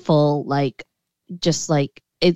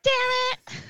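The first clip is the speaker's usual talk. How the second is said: mock dismay. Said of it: "we were like".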